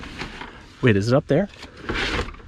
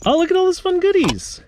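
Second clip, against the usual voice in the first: excited. Acted. Showing off box of junk.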